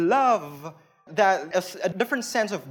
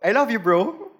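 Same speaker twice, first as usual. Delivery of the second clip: saying it to a bro; 3 semitones higher, 4 dB louder than usual. Shown as a demonstration. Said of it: "You can actually say".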